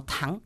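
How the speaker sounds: "tang" rising like a question; second tone TANG. Shown as a demonstration. Student demonstrates Chinese tones, "tang" rising. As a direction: lift "tang" upward as if asking. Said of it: "segundo tono".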